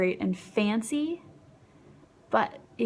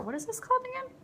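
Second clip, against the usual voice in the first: delighted surprise; 9 semitones higher than usual. Real, not acted. Reading the shade's name, delighted.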